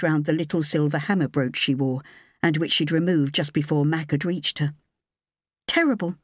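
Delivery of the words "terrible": higher, lighter woman's voice; young woman voice. A narrator's reading. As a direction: voice her higher and clear.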